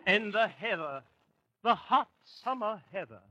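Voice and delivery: put-on lightly done English country-gent accent; dreamy English squire with an English accent; mock English accent. [name] recalls English summers, light mock English accent. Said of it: "this dialect of yours".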